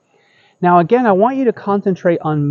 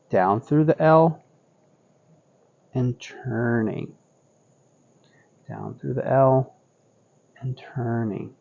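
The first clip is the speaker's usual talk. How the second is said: serene tai chi cues; 4 semitones lower, 9 dB quieter than usual. Acted. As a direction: calmly talk them through each turn. Paced cues during cloud hands.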